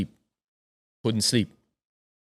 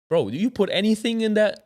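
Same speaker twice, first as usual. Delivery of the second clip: higher-pitched, probing question; suspicious question; 10 semitones higher than usual. Retold or imitated. Asking the driver what he put in it, suspicious. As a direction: question a friend in a high, doubtful voice.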